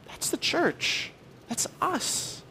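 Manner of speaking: dropped to a near whisper, slow; hushed emphasis. Real, not acted. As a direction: let it fall to a soft, breathy hush. Hushed climax of sermon point.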